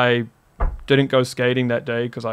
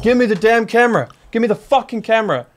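high, insistent imitation of an armed robber; retold gunman's demand; 8 semitones higher than usual. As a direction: replay the robber's angry order.

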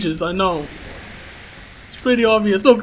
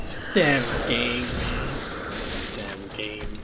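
low, very soft muttering; deflated grumble; 10 semitones lower, 9 dB quieter, much slower than usual. Real, not acted. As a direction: grumble under your breath, worn out. Deflated after dying.

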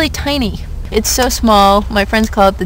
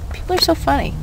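normal talking voice

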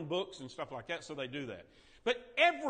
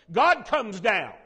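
God comes down said slowly and much louder; booming proclamation; 3 semitones higher, 10 dB louder, slower than usual. Acted. Sunday school teacher booming out the Bible moment for effect, slow and loud. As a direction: boom it out slow, like thunder. Dramatic Bible storytelling beat.

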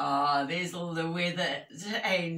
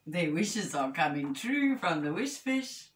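ordinary delivery, nothing marked

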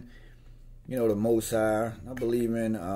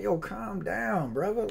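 calming someone down; 9 semitones higher, slower than usual. Retold or imitated. Talking down an angry customer.

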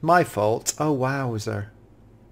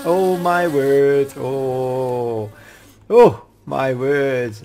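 awed sigh; much slower than usual. Real, not acted. Still awed by the wasps.

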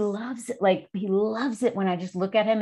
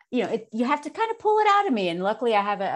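ordinary delivery

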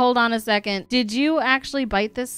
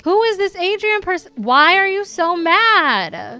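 higher-pitched, rude challenge; rude introduction; 7 semitones higher, 5 dB louder than usual. Acted. She meets the much-discussed Adrian, rude introduction. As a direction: demand it rudely, pitched up. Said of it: "gonna give a rude introduction".

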